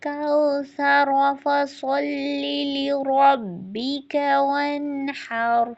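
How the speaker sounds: slow, melodic tajweed recitation; Quran recitation. Acted. Teacher recites surah for students in slow melodic tajweed. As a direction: chant each verse slowly and clearly.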